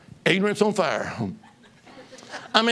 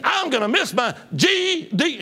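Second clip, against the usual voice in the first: higher, louder, slow griping; retold angry outburst; 9 semitones higher, 4 dB louder than usual. Man beside him on the plane, a retold angry gripe. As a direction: imitate a furious passenger. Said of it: "He said".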